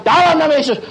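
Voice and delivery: acted bold rebuke in prayer; commanding prayer. Preacher retelling his stand against robbers, commanding. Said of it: "saya bilang".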